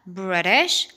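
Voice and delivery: "بريتش" with the T as a light D; American BRITISH. Shown as a demonstration. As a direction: say "بريتش" like "Briddish". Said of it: "في الأمريكان أكسنت هتتنطق".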